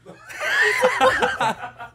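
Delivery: shrieking laugh breaks out; a real shrieking laugh; high burst of laughter. Real, not acted. Laughing at own story.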